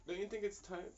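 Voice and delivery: ordinary delivery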